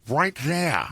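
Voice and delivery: attempted Scottish film-star voice; put-on movie-star impression; Sean Connery. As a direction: feel for the Scottish burr. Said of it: "I feel I've got it".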